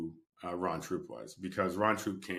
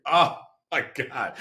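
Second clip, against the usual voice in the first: stunned; 7 semitones higher, 9 dB louder, slower than usual. Retold or imitated. Realizing it's Superman.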